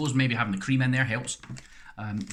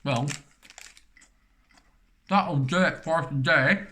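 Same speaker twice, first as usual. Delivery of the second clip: talking through a mouthful of cake; words round a bite of food; mouth full; 4 semitones higher, 3 dB louder, slower than usual. Real, not acted. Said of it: "never to talk with my mouth full".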